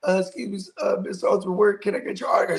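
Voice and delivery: high-pitched imitation of a nervous child; mock-nervous kid asking a favor; nervous little kid. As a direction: pipe up like a timid young fan. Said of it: "he's, like, hella nervous".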